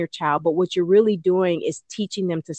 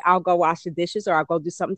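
usual speaking voice

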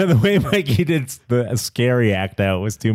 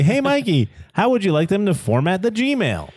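put-on high excited kid voice; 8 semitones higher than usual. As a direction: ask it like an eager child. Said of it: "Every week I say".

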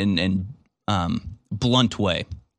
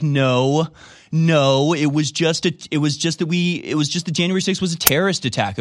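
mock indignant denial; 5 semitones higher than usual. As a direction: protest your innocence a bit too hard. Mocking Democrats' defense.

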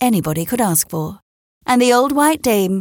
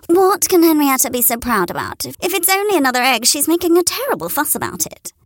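lightly high gossiping hen; 7 semitones higher than usual. A narrator's reading. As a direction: grumble to one another. Friends gossip about Henrietta.

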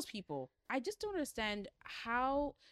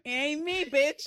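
sassy rejection; 4 semitones higher, 8 dB louder, slower than usual. Pretend. Rejecting the raccoon lover.

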